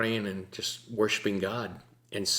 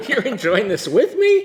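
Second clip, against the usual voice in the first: higher and louder, laughing all through; giddy, amazed laugh in the words; laughing delight; 12 semitones higher, 10 dB louder than usual. Retold or imitated.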